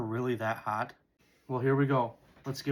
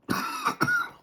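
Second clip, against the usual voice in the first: sharp strained cough; spicy cough. Real, not acted. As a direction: cough as the spice catches. Coughing mid-sentence from spice.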